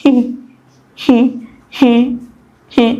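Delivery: high, separate "hi" giggles with gaps; imitated eerie laugh. Rider mimicking the strange passenger, eerie laugh. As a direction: mimic a ghostly laugh in short high bursts.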